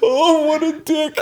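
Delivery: high, slow, crying with laughter; real laughing sob; teary laughter. Real, not acted. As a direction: gasp it out while wiping tears. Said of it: "I can't stop laughing".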